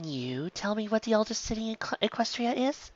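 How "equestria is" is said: plain reading voice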